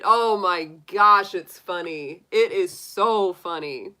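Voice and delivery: ordinary talk, nothing marked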